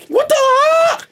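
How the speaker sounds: piercing high kiai shout; shrill kung fu yell; Bruce Lee. Retold or imitated. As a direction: let out a martial-arts battle cry. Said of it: "heard me do a Bruce Lee".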